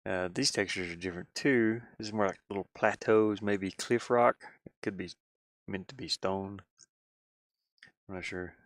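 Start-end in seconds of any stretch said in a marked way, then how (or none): none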